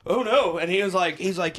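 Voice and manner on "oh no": a hint of fake panic, slightly higher; mock scared. Scared heckler facing the trooper, in mild mock panic. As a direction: play a light mock fright. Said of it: "the dude's like, scared".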